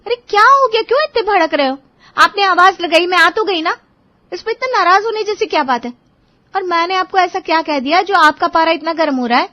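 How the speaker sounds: protest, taken aback by his temper; surprised wife. Acted. Wife protests her husband's sudden anger, high and indignant.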